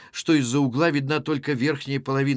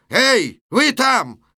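loud, high holler through a window; shouting; 9 semitones higher, 6 dB louder, much slower than usual. Acted. Satin calling into the window at a brawl, shouting. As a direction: call out sharply to people inside. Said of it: "Сатин кричит в окно".

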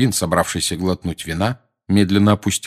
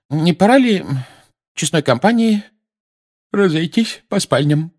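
sleepy voice; 10 semitones higher, 4 dB louder than usual. A narrator's reading. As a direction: suggest it drowsily in a raised pitch. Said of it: "сонным голосом предложила". (сонным голосом)

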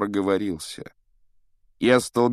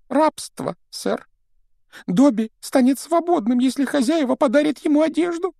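high, squeaky pleading elf; house-elf voice; 6 dB louder than usual. Acted. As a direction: play Dobby the anxious house-elf. Dobby explains the pillowcase. (тонкий голос домового эльфа)

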